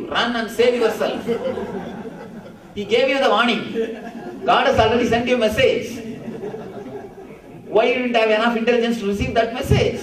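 high, loud, exasperated master; loud mock scolding. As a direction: scold the foolish disciple, with laughter in it. Master scolds disciple in story, amused.

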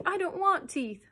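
put-on high, loud childish protest; furious roar. A narrator's reading. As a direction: shout it in a tantrum. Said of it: "roared John furiously".

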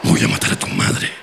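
susurro grave lento de muñeco poseído; voz grave de muñeque. Acted. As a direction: amenaza susurrada de muñeco diabólico. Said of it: "qué gran voz de muñeque". (muñeco diabólico con voz grave susurrada)

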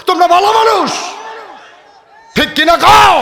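high, loud mocking praise; loud, high sarcastic preaching; sarcastic shouted rebuke. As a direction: jeer at them, sky-high and loud.

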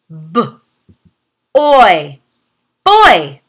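put-on "B, oi" said as separate sounds, then blended into "boy"; sounding out. Shown as a demonstration. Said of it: "Let's practice together".

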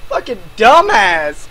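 ordinary talk, nothing marked

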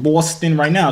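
put-on high, showy Boston accent on "Boston"; imitated Boston accent; practised Boston accent. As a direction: show off a Boston "Boston". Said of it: "See, I got it down packed".

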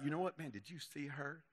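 hushed, high-pitched mock gossip; gossipy. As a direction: imitate a scandalized friend under your breath. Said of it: "little gossipy thing going on".

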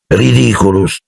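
casual, understated spell; almost lazy. A narrator's reading. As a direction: toss off the spell almost lazily. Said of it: "quasi pigramente". (incantesimo detto quasi pigramente)

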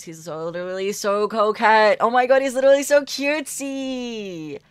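high, loud swoon over something cute; gushing. Real, not acted. Streamer adoring the character's pink ribbon, gushing.

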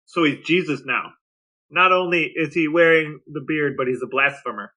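ordinary delivery

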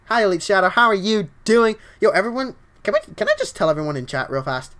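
normal talking voice

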